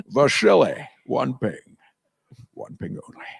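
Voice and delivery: slushy Scottish submarine captain; Scottish burr, trailing to a whisper; Sean Connery. Acted. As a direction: fade from firm to hushed. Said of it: "now I'm Hunt for Red October".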